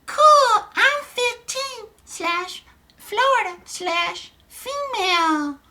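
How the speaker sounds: very high, slow put-on girl voice; girl's chat voice. Streamer voicing Sarah's chat message to Donnie, high girlish voice. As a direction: drag it out in a high falsetto. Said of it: "forgive my voices".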